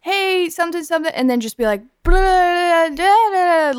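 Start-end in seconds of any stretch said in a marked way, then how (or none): 0.0-1.1 high, called-out greeting from far off; calling across. Retold or imitated. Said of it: "we were pretty far away". 2.0-3.8 higher, louder garbled babble; loud mumbled nonsense. Retold or imitated. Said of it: "mumble a bunch of shit, but really loud".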